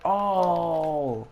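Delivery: mock crowd ooh; imitated crowd reaction. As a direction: stretch it like onlookers gasping at a fight.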